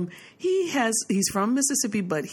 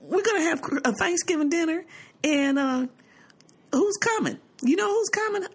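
high, sing-song mocking tone; mock mother voice; 8 semitones higher than usual. Daughter copies her mother's teasing, high and sing-song. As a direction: tease her by copying her style. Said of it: "I said".

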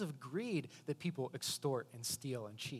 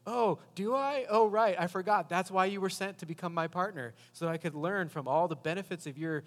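sarcastic; 6 dB louder than usual. Retold or imitated. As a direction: lay the sarcasm on thick at the start. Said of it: "she says very sarcastically".

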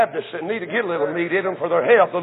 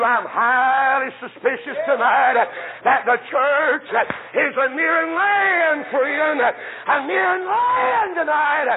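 preaching climax; 4 semitones higher, slower than usual. Real, not acted. Preacher to his church, rising to a sung peak. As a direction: pitch climbing higher, words stretched slowly on land.

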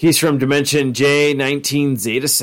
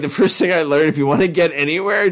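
advice in character; 5 semitones higher than usual. Retold or imitated. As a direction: give the dumb wisdom earnestly. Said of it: "He's like".

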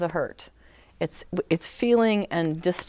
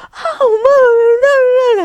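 put-on high, loud wailing child calling mom; crying child; 14 dB louder than usual. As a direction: howl for mom like a kid who banged a finger. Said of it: "they're like".

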